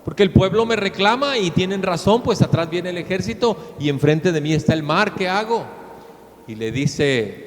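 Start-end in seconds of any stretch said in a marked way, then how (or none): 0.0-5.6 higher, pleading cry to the Lord, unsure what to do; desperate plea. Acted. Moses cries out desperately to God, sea ahead, army behind. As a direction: beg for help, panicked but restrained. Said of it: "Moisés, desesperado".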